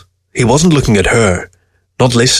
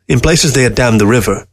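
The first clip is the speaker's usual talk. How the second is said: usual speaking voice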